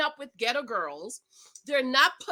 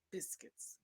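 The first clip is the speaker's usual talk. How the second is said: whispered; 25 dB quieter than usual. Real, not acted. Cheeky punchline.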